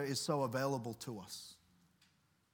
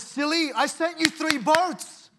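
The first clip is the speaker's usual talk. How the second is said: much higher, louder and slow, playfully scolding; God's reply; 10 semitones higher, 7 dB louder, slower than usual. Retold or imitated. God, to the drowned man in heaven, high slow playful scolding. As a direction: tease him gently for missing it.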